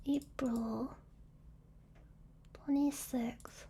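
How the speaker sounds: soft whispered date, slow; whispering. Real, not acted. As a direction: whisper it to yourself. Mutters the date while searching.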